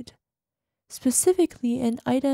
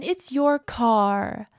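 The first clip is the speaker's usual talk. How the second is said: ordinary talk, nothing marked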